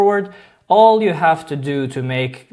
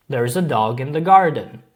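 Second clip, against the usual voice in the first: usual speaking voice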